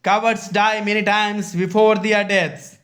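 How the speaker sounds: loud, high, slow declamation; Caesar. Acted. Teacher quoting Caesar's reply to his wife, loud slow declamation. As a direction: proclaim the line slowly and forcefully. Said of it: "तब जाकर के scissor कह रहा है".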